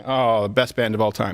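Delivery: ordinary talk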